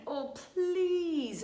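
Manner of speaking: high, drawn-out plea; pleading. Shown as a demonstration. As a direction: plead on a long falling please.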